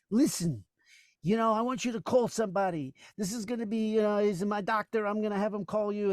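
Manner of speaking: high-pitched comedian impression; Gilbert Gottfried. Retelling the comedian's bit with napkins, high character voice. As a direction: put on a high, insistent stage voice. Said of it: "Gilbert was, like, really".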